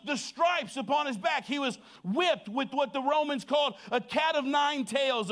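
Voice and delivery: high, forceful preaching with deliberate pauses. Real, not acted. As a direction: proclaim it to the whole congregation.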